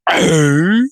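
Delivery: loud, high "eh?" noise; hammed-up imitation of a podcaster's noise; imitated grunt. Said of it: "He goes".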